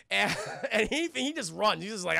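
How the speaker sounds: normal talking voice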